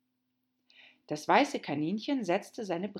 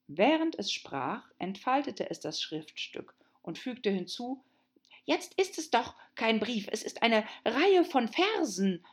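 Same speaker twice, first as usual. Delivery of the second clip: high, hurried and stammering; a flustered little rabbit, tripping over words; 6 semitones higher, 3 dB louder than usual. Acted.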